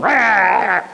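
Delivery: loud, high-pitched mock roar; angry roar. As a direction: roar wordlessly like a heated arguer. Two people escalating an argument.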